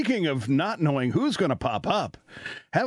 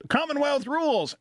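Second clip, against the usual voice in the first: high-pitched mock yell, no real volume; retold yell; 11 semitones higher than usual. Australian clerk to the whole coffee shop, retold yell. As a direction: imitate someone yelling out, pitched way up. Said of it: "he yells out".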